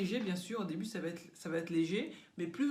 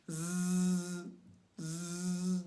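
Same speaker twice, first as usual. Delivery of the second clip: phone buzz Z; 2 semitones lower than usual. Shown as a demonstration. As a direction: hum a long Z through the teeth. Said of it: "sans reprendre son souffle".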